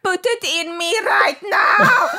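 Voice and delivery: loud, high, drawn-out South African shout; hammed-up South African bedroom demand, slow and shouted; exaggerated South African accent. Shown as a demonstration. Guest mocking her accent in bed, hammed-up South African shout. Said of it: "someone like this and being like".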